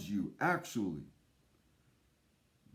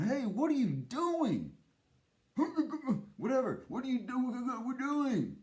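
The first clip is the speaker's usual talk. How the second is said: alarmed friend; 9 semitones higher than usual. Acted. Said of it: "your brother or sister there is going".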